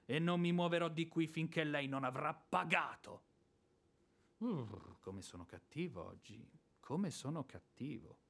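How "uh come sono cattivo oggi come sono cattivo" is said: much softer and lower, seething; muttering to himself. Acted. Smirnov grumbles to himself, quietly seething. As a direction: grumble under your breath. Mutters about his own bad temper.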